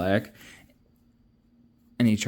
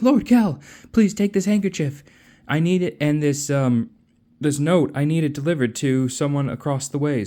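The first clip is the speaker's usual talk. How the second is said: higher, eager voice of a pleading stranger; 6 semitones higher, 3 dB louder than usual. Retold or imitated. Stranger asking Kel to deliver a note, high and pleading. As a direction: sell it as a nervous favour-asker.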